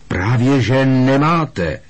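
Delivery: high, loud, slow shout. A narrator's reading. Boy shouting back at the minister, loud. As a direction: yell back in protest. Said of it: "rozkřikl se Harry".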